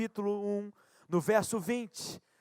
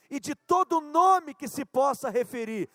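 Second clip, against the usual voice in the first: ordinary delivery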